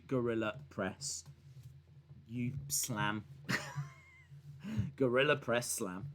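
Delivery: ordinary talk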